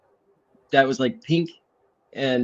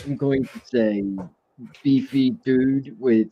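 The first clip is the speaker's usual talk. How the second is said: put-on British accent, halting delivery; hesitant mock British description. Shown as a demonstration. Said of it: "can you do a British accent".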